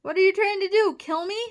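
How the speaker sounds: very high, indignant yell; outraged shout. A narrator's reading. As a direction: shout it like a wounded victim. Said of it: "shouted the giant slug".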